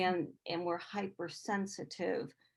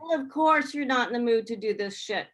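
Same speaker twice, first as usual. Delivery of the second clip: empathetic outburst; 4 semitones higher, 7 dB louder than usual. Retold or imitated. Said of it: "It's like".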